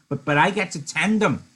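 put-on Lenny pressing the point like a child; insisting Lenny. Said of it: "Lenny broke in".

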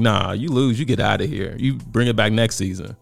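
ordinary delivery

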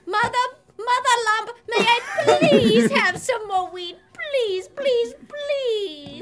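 hammed-up begging child. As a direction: beg harder on every please.

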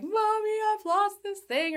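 high-pitched imitation of a kid crying to mom; put-on high voice of a distraught little kid; upset whining child. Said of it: "they're sobbing and probably snot".

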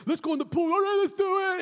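high, loud eager cheer; eager kid. Retold or imitated. Remembering jumping in the pool, high excited kid voice. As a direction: bounce with a child's eagerness.

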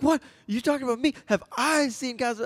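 high, incredulous Seagal echo; mock exasperated disbelief. Seagal asked about Casablanca, high and exasperated. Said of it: "Seagal's just so exasperated".

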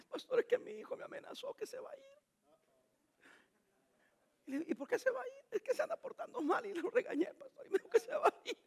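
high, soft, far-off whining father; whiny parent. Retold or imitated. Father tells the pastor his son threatened to leave, high and soft. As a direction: play a meek, complaining dad.

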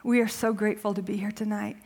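soft, low prayer voice; hushed opening prayer before a sermon. Real, not acted. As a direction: pray quietly and earnestly.

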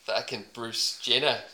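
a hint of a laconic Aussie bloke accent; deadpan Aussie accent, mostly his own voice; put-on Aussie accent. Podcaster riffing about a stranger in a light put-on Aussie accent. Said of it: "I've already got my accent".

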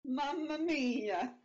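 drawn-out, slow mock Italian. Talking pizza with friends, a slow mock Italian exclamation. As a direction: stretch it out like a cartoon Italian. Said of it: "Italian influence".

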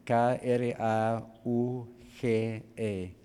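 K, R, A, U, G, E said slowly letter by letter; spelled out letter by letter. Shown as a demonstration. Preacher spelling karuge slowly for the congregation, letter by letter. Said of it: "La palabra ya es karuge".

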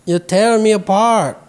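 slow film quote; Tommy Wiseau impression. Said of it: "Looking at Tommy Wiseau quotes".

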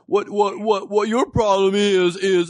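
hammed-up pushy talk show host; Doctor Phil voice. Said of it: "He's like".

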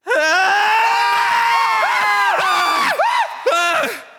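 high, booming ghostly laugh. Acted. Summoned ghost appears.

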